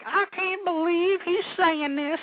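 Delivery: high, slow, drawn-out imitation; exaggerated disbelief in a put-on high voice; mock awe. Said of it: "that are in complete awe".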